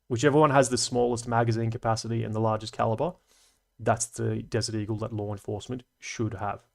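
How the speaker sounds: ordinary talk, nothing marked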